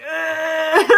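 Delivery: high, laughing dog's groan; a disappointed groan from the dog; laughing ugh in the dog's voice. Pretend.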